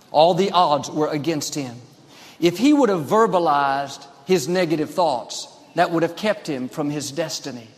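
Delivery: ordinary talk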